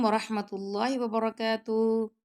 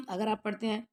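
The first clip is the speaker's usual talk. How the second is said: ordinary talk, nothing marked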